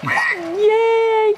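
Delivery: high, long squeal of praise; delighted high whoop; excited cheer. Real, not acted. Cheering the child.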